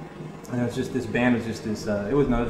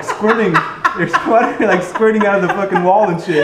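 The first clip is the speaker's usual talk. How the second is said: normal talking voice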